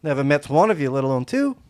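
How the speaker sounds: high, loud urchin voice fawning over the dog; cheeky urchin sweet-talking a giant dog; street kid voice. Acted. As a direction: coo at the big dog like a scrappy street kid.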